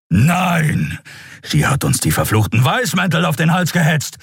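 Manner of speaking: raging, defiant outburst; angry refusal. A narrator's reading. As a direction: shout it back in fury. Enraged Keru refuses to let go.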